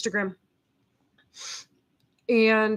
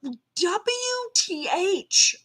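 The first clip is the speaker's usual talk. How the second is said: knee-jerk reaction; 7 semitones higher, much slower than usual. Retold or imitated. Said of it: "that knee-jerk reaction to be like".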